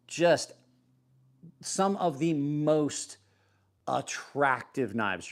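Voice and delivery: slow, savoring each word of attractive knives; slow, relishing praise of the knives; slow drawn-out. Shown as a demonstration. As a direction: stretch it out lovingly and slowly. Restates phrase after aside.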